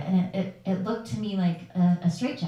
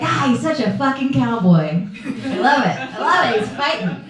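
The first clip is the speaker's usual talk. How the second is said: proud laughing; 5 semitones higher, 6 dB louder than usual. Retold or imitated. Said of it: "she was so upset 'cause I was just like".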